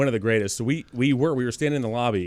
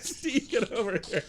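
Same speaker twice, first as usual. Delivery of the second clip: laughing mock call; 8 semitones higher, 6 dB quieter than usual. Replaying Austin's call, laughing.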